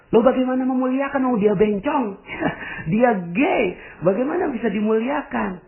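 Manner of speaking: imitating a listener's scandalised protest; mock indignant objection. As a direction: play the outraged listener who refuses.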